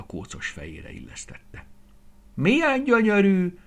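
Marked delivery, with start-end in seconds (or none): 2.3-3.6 high, loud awed blurt; blurted wonder. A narrator's reading. Rooster Gavallér blurting out his awe, high and loud. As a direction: blurt it out in amazement. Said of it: "bökte ki Gavallér".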